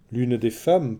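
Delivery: ordinary delivery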